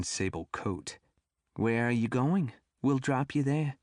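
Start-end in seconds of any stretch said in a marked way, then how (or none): 1.5-3.8 put-on grandmotherly Brooklyn accent, pitched up; old Brooklyn lady's accent; thick Brooklyn accent. A narrator's reading. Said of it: "in a thick Brooklyn accent".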